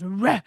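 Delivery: short loud mock grumble; imitated dog grump. Owner imitating her dog grumping at Copper, loud mock grumble. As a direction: bark out a little dog's grumble.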